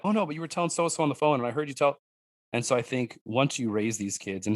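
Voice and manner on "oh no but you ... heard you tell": quick, higher kid voice, lightly imitated; insistent daughter. Daughter correcting dad about his phone call, lightly mimicked insistence. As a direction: rattle it off like a know-it-all child.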